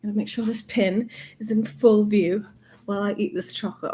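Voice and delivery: ordinary talk, nothing marked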